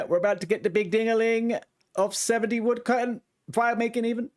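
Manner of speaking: high, rising with building glee; eager build-up to the level; excited. Real, not acted. Level-up about to hit.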